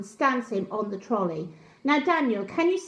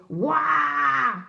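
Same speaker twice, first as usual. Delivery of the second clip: loud playful roar; child's mock monster shout; monster roar "wah"; 4 dB louder than usual. A narrator's reading. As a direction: jump out to scare her. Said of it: "to frighten the checkout lady".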